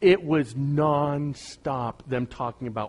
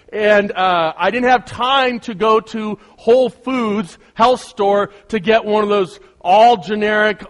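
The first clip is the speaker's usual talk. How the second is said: loud, high, driving sermon delivery; energetic preaching; 6 semitones higher, 7 dB louder than usual. Acted.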